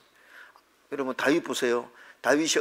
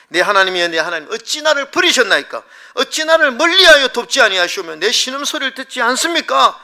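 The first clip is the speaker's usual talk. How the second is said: loud, high cry to God; acted psalmist's outcry, building in volume; impassioned lament; 8 semitones higher, 12 dB louder than usual. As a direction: declaim David's complaint with mounting force. Acting David's lament from the psalm.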